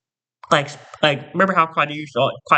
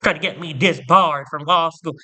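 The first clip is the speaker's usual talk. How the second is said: ordinary talk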